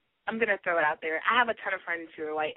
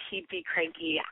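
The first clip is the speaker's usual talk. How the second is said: normal talking voice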